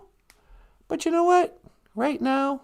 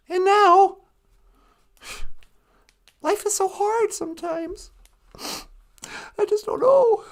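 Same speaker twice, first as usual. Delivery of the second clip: mock weary sigh; 6 semitones higher, slower than usual. Complaining about aging eyes, mock weary sigh. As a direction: ham up how hard life is, dragging it out.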